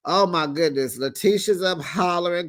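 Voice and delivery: normal talking voice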